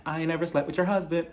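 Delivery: soft, understated practice take; mock line rehearsal. Host plays Jacqueline rehearsing her denial, soft mock run-through. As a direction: quietly test the line before the big take. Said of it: "I forgot my script".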